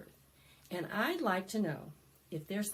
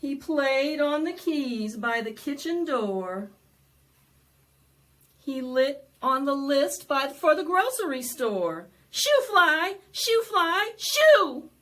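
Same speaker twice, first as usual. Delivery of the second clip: louder, bouncy rhyme reading; lively read-aloud; 6 semitones higher, 11 dB louder than usual. Acted. Librarian reading the fly book to children, loud and bouncy. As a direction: perform the book for a crowd of kids.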